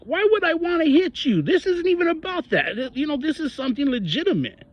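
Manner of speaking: high, incredulous disbelief. Retold or imitated. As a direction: voice shooting up, baffled. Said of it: "And I'm like".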